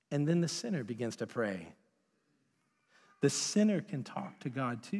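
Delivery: ordinary talk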